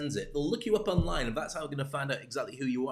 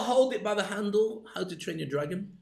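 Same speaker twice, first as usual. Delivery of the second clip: ordinary delivery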